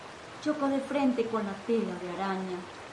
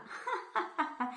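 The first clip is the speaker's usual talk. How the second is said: high, falling giggle; mocking laugh; 8 semitones higher, 8 dB quieter than usual. Bugs mock the cricket's match. (risa burlona y aguda)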